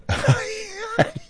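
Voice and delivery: high-pitched real laugh. Real, not acted. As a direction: laugh helplessly at your own memory. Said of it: "I'm laughing because".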